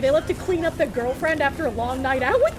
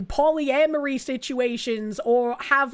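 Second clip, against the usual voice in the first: normal talking voice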